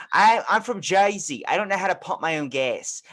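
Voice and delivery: broad Jersey accent impression. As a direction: imitate a woman from Jersey. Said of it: "you hear someone from Jersey".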